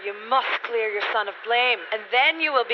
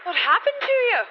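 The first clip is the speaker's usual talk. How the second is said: much higher, alarmed question; shocked; 4 dB louder, faster than usual. Acted. Mother seeing her son's throat wound, shocked. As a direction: recoil at the sight of the wound.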